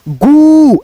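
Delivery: "गू" held long on the ऊ; slow, sustained demonstration of "गू"; drawn-out long vowel. Shown as a demonstration. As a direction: pull the vowel of "गू" out slowly. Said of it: "थोड़ा सा खींचना होता है".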